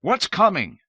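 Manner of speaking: loud, high demand into the dark; demanding cry. A narrator's reading. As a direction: shout at the unseen wailer. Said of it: "he cried".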